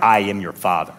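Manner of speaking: very low, deep pronouncement; mock-godlike father. Preacher talking to his kids, mock-godlike deep voice. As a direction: drop way down for a grand paternal decree. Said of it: "I'm gonna be like".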